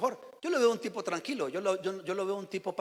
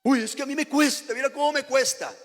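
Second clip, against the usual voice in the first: mock whining; 6 semitones higher, 5 dB louder than usual. Mocking a lazy believer's complaint.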